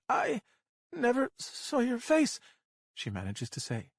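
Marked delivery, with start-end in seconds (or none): 0.1-2.4 trying to stay even. A narrator's reading. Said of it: "trying to keep her voice even".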